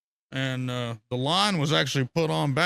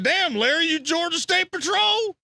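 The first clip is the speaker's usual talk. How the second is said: high, slow teasing cop question; mock accusation; 4 dB louder than usual. To Larry, the narrator teases him in a high slow mocking voice. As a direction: needle him like he's a state trooper. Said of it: "I said".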